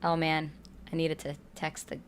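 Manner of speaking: ordinary delivery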